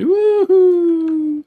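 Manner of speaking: long, high, loud cheer; celebration whoop. Real, not acted. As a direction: whoop it out in joy. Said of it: "Okay, enough celebration".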